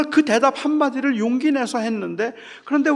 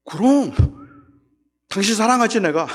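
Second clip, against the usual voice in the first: shameless reply; 4 dB louder than usual. Retold or imitated. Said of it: "이렇게 말하면 뻔뻔한 인간이 되는 거예요".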